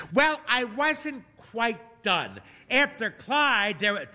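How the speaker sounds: put-on high, slow old lady protest; insistent old lady. A narrator's reading. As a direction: drag it out, not done yet.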